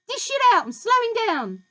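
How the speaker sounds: high, loud greedy yell, "give" hit hard. A narrator's reading. Grandma yelling at George for another dose.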